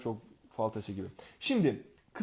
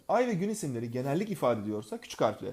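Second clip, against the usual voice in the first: ordinary talk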